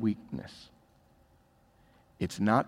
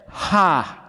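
mocking laugh; 5 semitones higher, 4 dB louder than usual. Mocking crowd at the cross.

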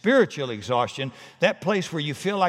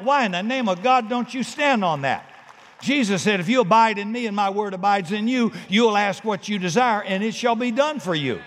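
louder, higher, driving pulpit voice; building fervent sermon cadence; full-force preaching; 6 semitones higher, 4 dB louder than usual. Acted. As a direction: preach it out to the congregation.

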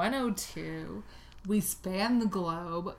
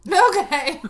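amused okay; 10 semitones higher, 11 dB louder than usual. Real, not acted. Amused reaction to wine.

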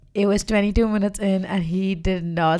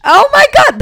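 squealed much higher and louder; high excited squeal; excited oh my God; 11 dB louder than usual. Real, not acted. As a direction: gush with sudden delight. Remembering the comedian she introduced.

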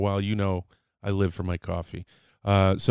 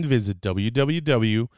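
ordinary delivery

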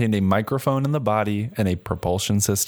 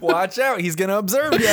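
mock warning; 8 semitones higher than usual.

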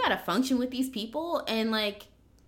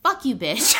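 mock harsh snap; 4 semitones higher, 7 dB louder than usual. Harsh version of feedback.

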